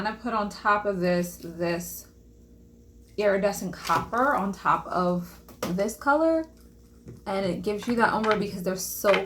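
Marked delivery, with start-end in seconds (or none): none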